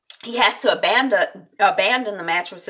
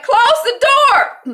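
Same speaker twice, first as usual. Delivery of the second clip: high, loud, drawn-out acted yell; shouted order; 10 dB louder, much slower than usual. Jack ordering his child back from fire, shouting. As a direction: imitate an urgent command across a hallway. Voices dad ordering door shut.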